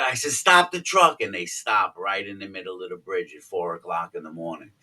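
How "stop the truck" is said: high-pitched retold shout; urgent call. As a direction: reenact yelling to the driver. Repeats shout to the driver.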